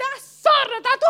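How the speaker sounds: high, loud laughing yell; mock panic yell. Church members facing the witches, loud laughing yell. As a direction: shout it half laughing. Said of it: "e voi dite".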